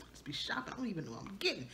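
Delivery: breathy laughing whisper, half to herself. Real, not acted. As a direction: laugh it off under your breath. Laughing aside while unpacking.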